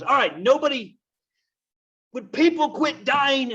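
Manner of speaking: very high, loud, slowed, fed-up complaint; a comic wail of disbelief; exasperated. Acted. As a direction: Friar Lawrence, fed up, begging people to quit dying, high and loud. Friar finds more dead.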